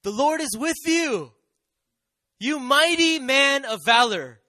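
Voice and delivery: loud, high, drawn-out angelic greeting; booming proclamation. Acted. Angel greets hiding Gideon as a hero, loudly proclaiming. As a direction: proclaim it like a herald, big and slow.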